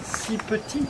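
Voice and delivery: slow, measured reading with long pauses; poem recitation. Acted. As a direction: savour each line of your own verse. Recites his poem on tour.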